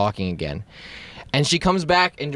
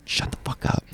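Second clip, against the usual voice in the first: urgent whisper, under the breath; acting out a secret order to shut up; whispered warning; 7 dB quieter than usual. Hissed warning as lady passes.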